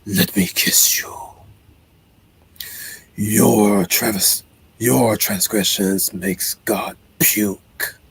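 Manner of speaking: slow, whispered demon voice; a demon whispering a slow, seductive threat; eerie whispered demon, drawn out; creepy demon. Acted. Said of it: "when the demon says it wants a kiss".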